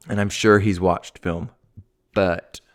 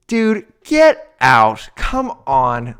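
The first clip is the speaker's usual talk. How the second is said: riled up; 2 semitones higher, 4 dB louder, much slower than usual. Real, not acted. Host venting at Deion's first meeting, exasperated. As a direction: drag it out in disbelief. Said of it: "it gets me so riled up".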